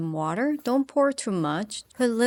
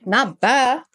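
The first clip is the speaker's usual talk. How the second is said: mock echo; 4 semitones higher, 6 dB louder than usual. Mocking echo in put-on voice.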